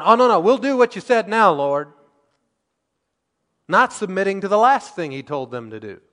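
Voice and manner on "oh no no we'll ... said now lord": high, loud whiny protest, hammed up; mock backpedaling plea. Preacher mimics Israelites pleading with God, mock whiny and loud. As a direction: play the people caving in with a whine.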